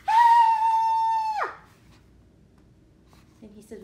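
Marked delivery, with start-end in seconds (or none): 0.1-1.5 very high, loud scream of fright; frightened shriek. Acted. Pigeon screaming as the huge puppy appears, terrified. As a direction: shriek like a startled bird.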